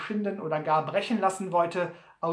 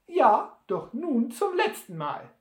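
high-voiced Sam; 7 semitones higher, 4 dB louder than usual. Sam answers in his high voice.

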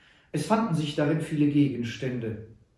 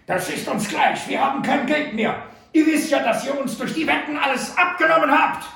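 much higher, louder protest; angry shout; 13 semitones higher, 8 dB louder than usual. A narrator's reading. Butler shouts that they have no money, furious. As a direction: yell it in frustration.

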